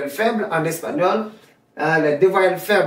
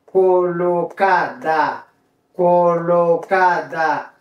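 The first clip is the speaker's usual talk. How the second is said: very slow Spanish word drill; pronunciation model; slower than usual. Shown as a demonstration. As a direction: stretch every syllable evenly, falling at each word's end. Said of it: "c'est pour ça prononcer".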